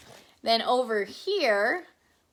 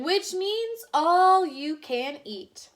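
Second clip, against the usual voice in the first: higher and slow, relishing it; savoring explanation; 7 semitones higher, 5 dB louder, slower than usual. Acted. Husband explaining to wife, slow and relishing. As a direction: let the promise of food sink in. Mister Watson explains bottomless.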